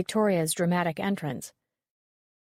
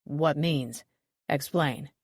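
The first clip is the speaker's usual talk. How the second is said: lightly voiced stern detective; 3 semitones lower, much slower than usual. A narrator's reading. Detective demands explanation.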